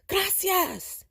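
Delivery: high, far-reaching alarmed cry; alarmed call. Acted. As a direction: call out for the turkey. Miguel calls out in alarm.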